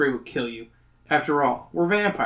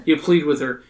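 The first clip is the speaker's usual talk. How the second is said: ordinary talk, nothing marked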